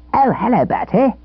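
high, grinning schoolboy voice; a cheeky boy's grinning hello. A narrator's reading. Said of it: "he said, grinning all over".

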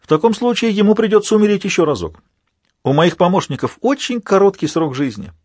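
high gloating villain voice; mocking villain. A narrator's reading. Villain mocking over dead sniper.

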